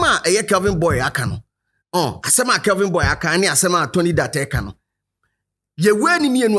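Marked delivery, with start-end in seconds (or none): none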